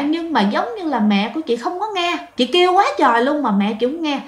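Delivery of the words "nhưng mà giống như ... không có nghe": usual speaking voice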